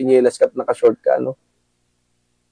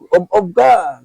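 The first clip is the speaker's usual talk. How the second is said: mocking insult; 7 semitones higher, slower than usual. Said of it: "mga ganun".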